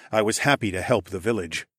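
ordinary delivery, nothing marked

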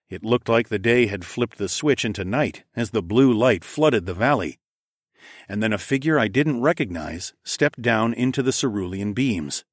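plain reading voice

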